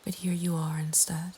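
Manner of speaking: ordinary delivery, nothing marked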